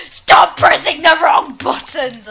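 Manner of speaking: loud, drawn-out frustrated shout; screaming at self. Real, not acted. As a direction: yell at yourself, stretching the words. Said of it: "I'm screaming at myself now".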